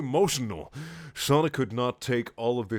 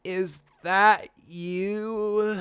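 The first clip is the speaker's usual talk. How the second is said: slow, higher and frail; feeble voice; 9 semitones higher, much slower than usual. Acted. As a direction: drag it out weakly. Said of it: "in a voice like a person who was living poorly".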